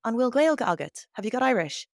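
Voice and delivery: a friendly stranger asking if you speak Irish; asking about Irish. Retold or imitated. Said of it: "someone saying to them".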